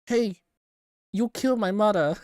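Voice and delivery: put-on loud, high jokey Hong Kong accent; mock Hong Kong accent. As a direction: yell it like a hammed-up Hong Kong stranger. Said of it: "I am legally allowed to use that accent".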